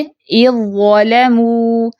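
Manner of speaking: slow recitation, ذ fully absorbed into ظ in اِذْ ظَلَمُوا; tajweed recitation. Shown as a demonstration. Teacher demonstrates idgham of dhal into za, slow recitation. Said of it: "ذال کی اپنی آواز یا پہچان باقی نہیں".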